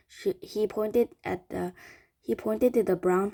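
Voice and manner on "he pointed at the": ordinary delivery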